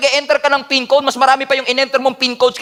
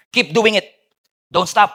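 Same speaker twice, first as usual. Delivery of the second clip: ordinary delivery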